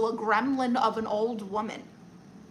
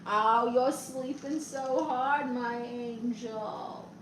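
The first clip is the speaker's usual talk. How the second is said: slow, drawn-out pet talk, fading softer; tender cooing; 5 dB quieter, slower than usual. Real, not acted. Owner talks to their sleeping dog, tender cooing. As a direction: coo lovingly to a sleeping pet.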